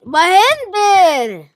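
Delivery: loud, slow, high holler; drawn-out shout. Real, not acted. Player calling out mid-game, drawn-out holler. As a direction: stretch it out as a rising-then-falling yell.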